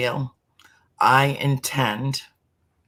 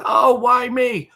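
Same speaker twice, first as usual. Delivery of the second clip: much higher, louder, slow whiny wail; mock self-pity; 13 semitones higher, 7 dB louder, slower than usual. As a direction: parody the poor-me lament. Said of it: "the question that most people say is".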